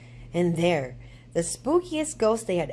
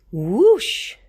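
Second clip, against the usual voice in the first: loud, high whoosh; loud swooshing whoosh; 5 semitones higher, 10 dB louder than usual. A narrator's reading. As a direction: sweep the cape with sound. Cape swoosh sound effect.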